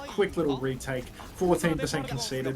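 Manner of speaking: normal talking voice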